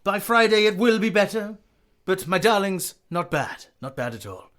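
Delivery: high, loud, theatrical ballet master. Acted. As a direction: dole out praise like a fussy teacher. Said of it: "Mister Hattori is like".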